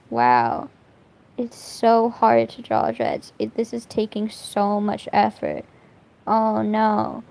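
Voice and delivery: sarcastic mock struggle, deadpan; [name]. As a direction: whine mockingly as if it's a huge chore. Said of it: "my name is [name]".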